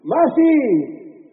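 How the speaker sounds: high drawn-out shout of MASI; grito. Shown as a demonstration. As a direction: belt out MASI as a crowd cue. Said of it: "voy a gritar". (grito agudo y alargado)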